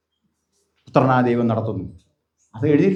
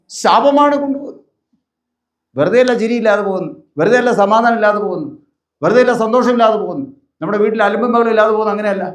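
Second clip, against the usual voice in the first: raised, fervent pulpit voice, hammering each line; preaching at full force; 6 semitones higher than usual. Acted. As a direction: drive the repeated warnings home to the congregation. (ആവേശത്തോടെയുള്ള പ്രസംഗം)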